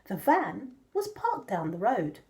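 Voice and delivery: usual speaking voice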